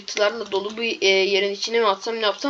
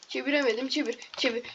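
ordinary delivery

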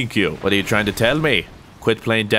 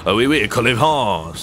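French accent. Shown as a demonstration. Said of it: "Doesn't matter how you say it".

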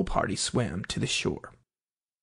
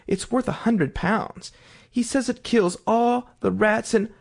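higher, eager and bubbling; 6 semitones higher than usual. A narrator's reading. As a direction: gush about the little dog. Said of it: "so Alice went on eagerly".

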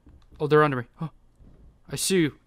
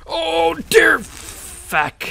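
long, drawn-out high wail; dismayed cry; 13 semitones higher, 5 dB louder, much slower than usual. Real, not acted. Gamer reacting to sudden trouble, dismayed cry. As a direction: stretch out a loud, dismayed curse.

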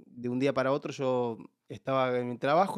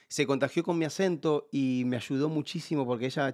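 ordinary delivery

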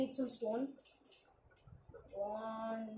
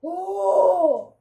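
loud high wail; 7 semitones higher, 13 dB louder than usual. Real, not acted. Crying exclamation.

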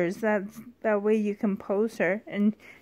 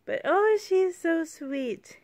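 high doting coo; 6 semitones higher than usual. Real, not acted. Cooing over a doll.

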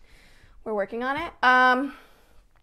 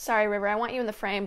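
ordinary talk, nothing marked

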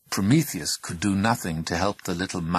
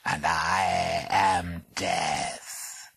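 purring voice; 2 semitones lower, much slower than usual. Acted. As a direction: purr it slow and deathly. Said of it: "said the smallest purring voice".

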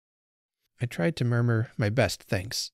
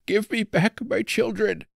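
put-on much higher, pleading cry of an old man; desperate cry; 11 semitones higher, 4 dB louder than usual. A narrator's reading. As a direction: beg for your lost children, crying out. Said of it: "he cried".

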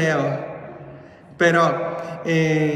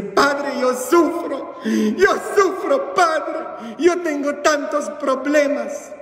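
higher, hammed-up whining to the priest; mock lament; 9 semitones higher, slower than usual. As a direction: play a parishioner moaning about suffering. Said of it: "Todo el mundo viene y dice".

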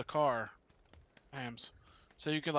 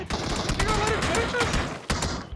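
panicked shout; 8 dB louder than usual. Real, not acted. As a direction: yell at the teammates in alarm. Panicked plea during fight.

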